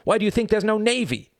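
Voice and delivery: very high, exasperated question; shouted challenge. A narrator's reading. Reg argues the rules to the boys, exasperated shout. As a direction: throw the question out, pitched high. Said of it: "shouted Reg".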